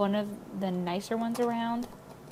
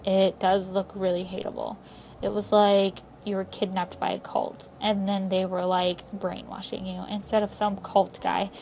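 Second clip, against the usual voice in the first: ordinary talk, nothing marked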